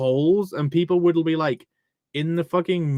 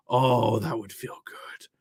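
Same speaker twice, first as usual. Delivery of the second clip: whisper; 5 semitones lower, 3 dB quieter than usual. Real, not acted. Longing for sheepskin.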